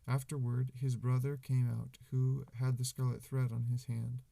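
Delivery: loud, projected scripture reading; Bible reading. Acted. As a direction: read scripture out to a room.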